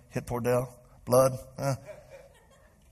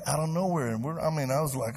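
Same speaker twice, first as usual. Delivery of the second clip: ordinary delivery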